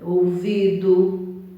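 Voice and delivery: slow "ouvido" ending in an U sound; "ouvido" with last O sounded as U; final O as U. Shown as a demonstration. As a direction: draw out "ouvido" as ou-VI-du. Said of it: "mas tem som U".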